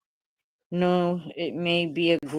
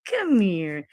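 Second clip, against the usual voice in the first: playful pet talk; 3 semitones higher, slower than usual. Real, not acted. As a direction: tease the dog gently, drawn out. Dog rubbing on her.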